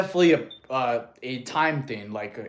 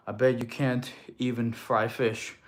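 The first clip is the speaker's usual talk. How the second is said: ordinary talk, nothing marked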